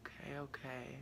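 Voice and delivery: breathless whisper; whispered okay. Real, not acted. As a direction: whisper it to steady yourself. Calming herself after reading.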